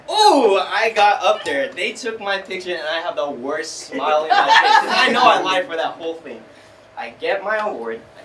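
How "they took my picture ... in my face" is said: ordinary talk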